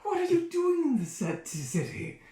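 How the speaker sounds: high, loud fawning question; flamboyant fop. Acted. Flamboyant stranger asking Bacchus why he's in town.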